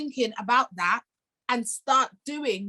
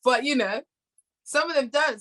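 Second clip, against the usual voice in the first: ordinary talk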